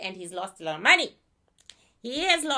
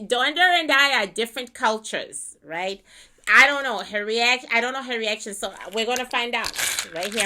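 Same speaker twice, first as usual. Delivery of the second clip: usual speaking voice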